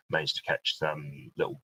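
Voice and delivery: ordinary talk, nothing marked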